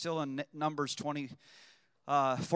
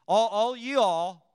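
put-on Southern accent; 3 dB louder than usual. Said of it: "that was Southern".

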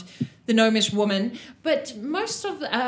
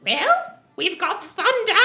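lightly voiced gnome, pitched up; boastful gnome woman; 7 semitones higher, 4 dB louder than usual. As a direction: play a showy little gnome, higher than usual. Game master acts boastful gnome woman.

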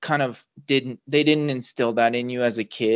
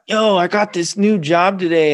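higher and louder, a bright burst of news; excited announcement; 7 semitones higher, 7 dB louder than usual. Retold or imitated. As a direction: bounce in excited about the new job. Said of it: "you're excited about something and you're like".

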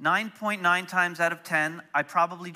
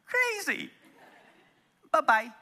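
mocking dismissal; 11 semitones higher than usual. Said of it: "people are like".

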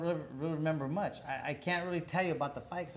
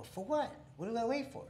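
puzzled protest; 8 semitones higher than usual. Retold or imitated. Kid pushing back at the trainer.

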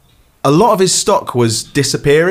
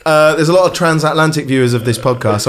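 ordinary delivery

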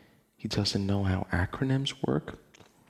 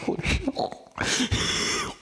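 shocked disbelief; slower than usual. Real, not acted. Streamer reacting to a baffling forum post, high disbelieving shrieks. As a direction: squeal it out as if you can't believe your eyes.

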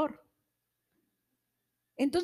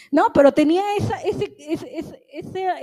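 laughing through the words after being corrected; 3 semitones higher, 6 dB louder than usual. Real, not acted. Caught out by a brother, she laughs her way back into the sermon. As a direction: talk on through a real laugh, a bit faster.

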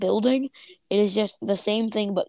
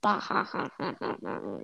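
fake laugh; 2 semitones lower, 6 dB quieter than usual. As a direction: fake a sinister "bah, ha, ha" that fades away. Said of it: "That wasn't even real laughing".

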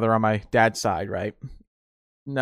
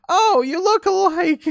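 high, slow flustered relative voice; 5 dB louder, slower than usual. Retold or imitated. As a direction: cover the mix-up sweetly. Relative covering mistake.

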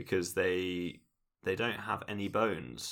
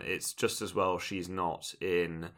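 ordinary talk, nothing marked

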